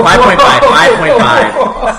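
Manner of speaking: high, loud, laughing shout; urgent yell breaking into laughter; shouted correction. Real, not acted. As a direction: shout the correction over the uproar. Correcting himself over group's uproar.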